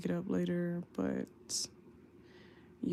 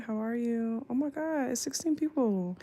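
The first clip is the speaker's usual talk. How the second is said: ordinary talk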